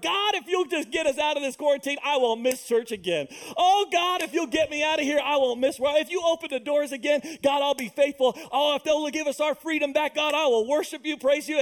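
pleading prayer. Retold or imitated. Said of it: "I heard".

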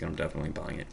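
ordinary delivery

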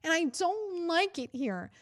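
sneering imitation of a complaining wife; whiny mock Heidi. Mocking Heidi complaining about the cold in a group chat, whiny. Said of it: "Heidi bitching on a group chat where she's like".

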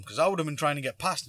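ordinary talk, nothing marked

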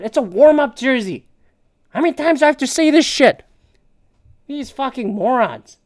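exasperated rant. Real, not acted. Ranting at commenters about warm-up jerseys, exasperated. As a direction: vent at the stupid commenters.

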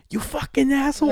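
high-pitched mock-furious yell; hammed-up road-rage voice; mad lady driver. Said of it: "this one lady, bro, got so mad".

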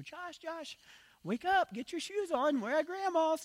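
very high, singsong imitation of a mother; slow falsetto coaxing a sleepy child; mom's wake-up voice. Son imitating mom waking him in the car, high motherly voice. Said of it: "I could still hear her voice".

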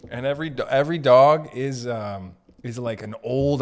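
usual speaking voice

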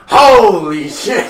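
high, loud, drawn-out exclamation; imitated amazed reaction. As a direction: stretch it out in mock amazement. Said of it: "kinda like, kinda like".

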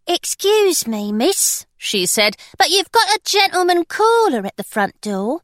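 a nervous maid announcing a caller; parlour-maid. Acted. Bess the maid speaking.

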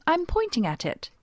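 losing patience with the baker; exasperated. Retold or imitated.

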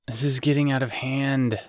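plain reading voice

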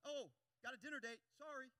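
loud, high yelp into excuse; yelp, then beg off; startled excuse-making. Acted. Preacher mimicking bystanders fleeing trouble, startled excuse. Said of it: "and we're just like".

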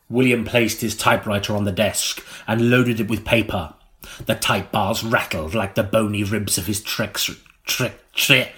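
ordinary delivery

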